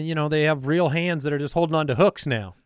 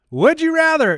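high, loud segment announcement; show announcer; 10 semitones higher, 5 dB louder than usual. Real, not acted. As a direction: sing out the segment name like a host.

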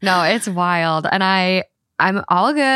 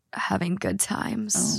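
whisper; 8 dB quieter, slower than usual. Acted. Spooky tease of dark stories.

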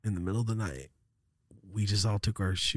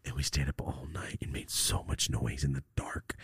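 hushed whisper; 7 semitones lower, 6 dB quieter than usual. Retold or imitated. Kids making noise at night.

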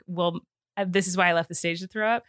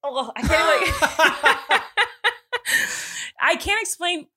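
laughing; 6 semitones higher, much slower than usual. Real, not acted. Disgusted giggling fit.

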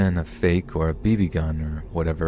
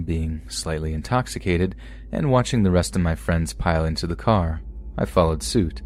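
usual speaking voice

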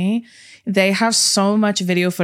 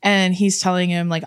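ordinary talk, nothing marked

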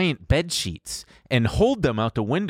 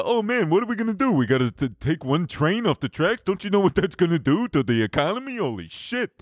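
mock panic; 5 semitones higher than usual. Mocking panicking executives.

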